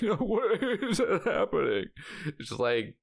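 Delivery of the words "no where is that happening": mock dismay. Retold shocked viewer reaction.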